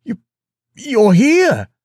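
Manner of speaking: halting, then high loud cry; stumble, then burst out high and loud; astonished joy. A narrator's reading. Farmer realises his king is home, astonished outburst.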